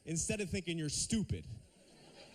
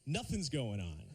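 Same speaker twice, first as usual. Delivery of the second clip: normal talking voice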